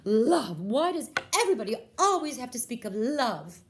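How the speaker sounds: very high, loud scornful whine; exasperated princess. Acted. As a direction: whine like a spoiled royal. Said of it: "the princess is like".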